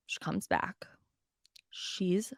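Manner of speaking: ominous storyteller hush; hushed whisper. Real, not acted. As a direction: drop to a whisper for the reveal. Dramatic pause in the story.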